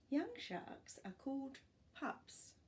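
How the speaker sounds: ordinary delivery, nothing marked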